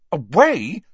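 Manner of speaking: sharp, loud outraged echo; Harry fires the word back in anger; angry repeat. A narrator's reading. Harry to McGonagall, loud angry disbelief. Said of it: "Harry repeated angrily".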